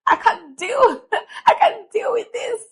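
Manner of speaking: high-pitched, hammed-up whine with a laugh; mock wailing. Venting to sister on the phone, mock wailing.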